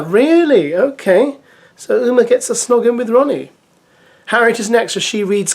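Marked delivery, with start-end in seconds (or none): 0.0-1.4 surprised. Real, not acted. Surprised reaction to dare.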